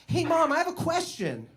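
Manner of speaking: put-on high, innocent kid voice; sing-song high-pitched child; kid asking mom. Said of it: "go to our parents and be like".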